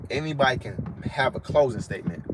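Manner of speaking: normal talking voice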